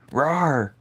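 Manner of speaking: monster rawr. Retold or imitated. Monster roar imitation.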